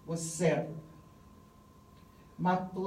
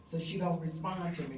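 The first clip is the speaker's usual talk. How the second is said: usual speaking voice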